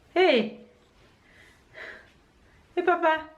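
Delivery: high bright call to her son. Real, not acted.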